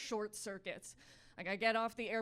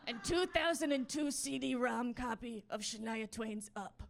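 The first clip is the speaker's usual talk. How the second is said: goblin voice. Acted. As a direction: talk like a baffled little goblin in broken sentences. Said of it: "the goblin that's running on a treadmill in my head".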